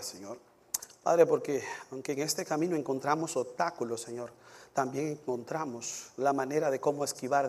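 earnest, subdued pastoral prayer. Real, not acted. Pastor praying to God for the congregation, reverent and subdued. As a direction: pray steadily, letting each "Señor" drop away.

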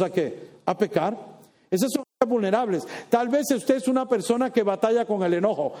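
ordinary delivery

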